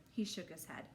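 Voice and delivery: usual speaking voice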